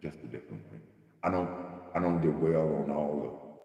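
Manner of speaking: usual speaking voice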